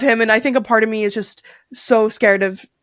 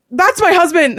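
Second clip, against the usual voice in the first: urgent plea; 8 semitones higher, 3 dB louder, slower than usual. Retold or imitated. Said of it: "I'm just like".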